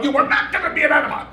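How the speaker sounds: high, fast, pressed refusal through laughter; retold insistence.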